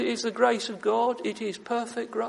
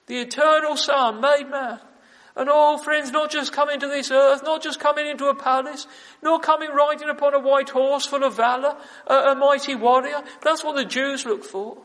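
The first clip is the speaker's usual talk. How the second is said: louder, higher pulpit voice; impassioned preaching; 3 semitones higher, 8 dB louder than usual. Acted. Preacher telling friends how Christ came, fervently. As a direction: proclaim it with fire.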